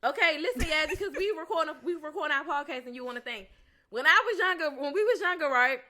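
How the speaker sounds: laughing, excited explanation. Real, not acted. Excited laughing phone call.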